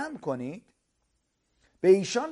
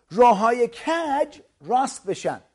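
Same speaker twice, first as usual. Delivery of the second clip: each word drawn out and louder; slow emphatic preaching; 5 semitones higher, 6 dB louder, slower than usual. Acted. Preacher explaining the valley to listeners, slow and emphatic. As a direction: lean on every word for the crowd.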